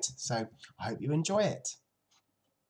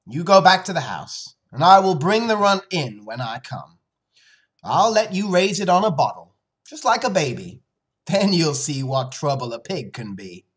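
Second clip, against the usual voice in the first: louder, raised, firm instructions; lightly voiced father laying out terms; relenting father; 6 semitones higher, 10 dB louder than usual. Father lets his daughter raise the runt, firm and loud.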